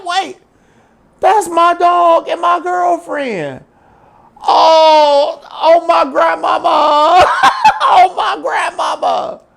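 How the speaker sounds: high, loud screaming through laughter; laughing scream. Retold or imitated. Boyfriend sees the camera footage, high laughing scream. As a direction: scream it in horrified disbelief, cracking up. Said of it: "He started screaming".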